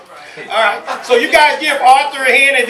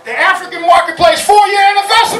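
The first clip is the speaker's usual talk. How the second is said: announcing; 8 semitones higher than usual. Real, not acted. Host welcoming the crowd to the anniversary, excited announcing. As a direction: hype up the anniversary.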